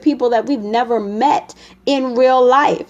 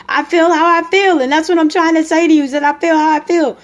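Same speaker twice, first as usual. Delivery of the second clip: higher, louder exasperated yell, pushed out fast; mock yelling; 6 semitones higher, 4 dB louder, faster than usual. Upset partner shouting back at the question, heated yell. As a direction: blow up, repeating yourself in a fast heated rush. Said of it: "You're just screaming and hollering".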